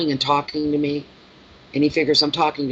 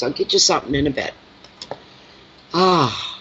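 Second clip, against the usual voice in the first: usual speaking voice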